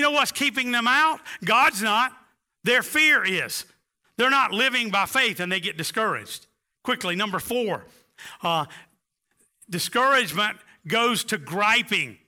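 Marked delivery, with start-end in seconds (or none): none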